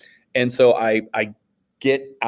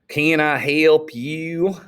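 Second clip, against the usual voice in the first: slow, higher Southern attendant voice; drawling clerk; 6 semitones higher, much slower than usual. Retold or imitated. Teacher voicing the gas station clerk to the class, slow and drawled. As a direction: stretch it out like a rural clerk.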